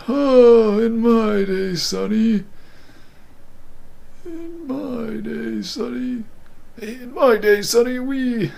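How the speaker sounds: put-on high, quavering old geezer voice; old person. Streamer tests breathy voice as an old man, high and wavering. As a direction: play a creaky old grandpa scolding. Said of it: "this is like an old person".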